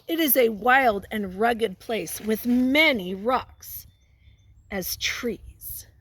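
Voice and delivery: put-on slow read-aloud voice for kids; story reading. Teacher reads a picture book to children, slow storytelling. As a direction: read it slowly to the class.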